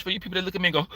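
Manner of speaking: usual speaking voice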